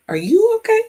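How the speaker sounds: high squeaky question. Shown as a demonstration. Checking in on her viewers, high and playful. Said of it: "find out if everybody was okay".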